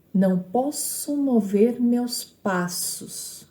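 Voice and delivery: slow, measured reading of the verse; verse read syllabically. Shown as a demonstration. Teacher reading the first line to count syllables, slow and measured. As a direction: draw out "Não posso mover meus passos" syllable by syllable. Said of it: "pegando a primeira linha".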